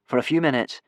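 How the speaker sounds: ordinary delivery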